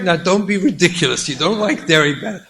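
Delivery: ordinary delivery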